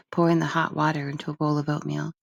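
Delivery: plain reading voice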